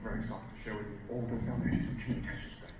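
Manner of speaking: very quiet, low, quick mumble to the audience; tiny, underpowered presenter voice; barely audible speaker. Shown as a demonstration. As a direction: announce excitement so softly nobody can hear. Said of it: "imagine I came out and I said".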